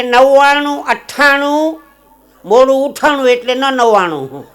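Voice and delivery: high, loud, drawn-out number chant; loud number dictation. Acted. Man dictating his mobile number to a friend, loud and drawn out. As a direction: dictate it loudly, stretching each number.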